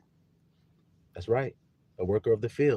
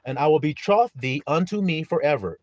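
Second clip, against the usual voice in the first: loud, declaimed Bible verse; preached scripture reading; 5 semitones higher, 9 dB louder than usual. Acted. Preacher reading prophecy to his flock, loud scripture declamation. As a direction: proclaim the verse with force. Reads scripture at full force.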